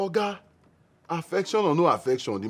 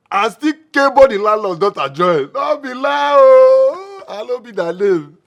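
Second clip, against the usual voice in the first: louder, higher mock outrage at his friend; loud excited teasing; 7 semitones higher, 11 dB louder than usual. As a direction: rib him loudly about the landlord's daughter. Teasing friend about landlord's daughter.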